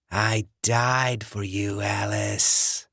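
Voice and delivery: slow, breathy rasping whisper of a corpse; undead John. Acted. Dead ex-boyfriend luring Alice, slow rasping whisper. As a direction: hiss it through the door like a dead man.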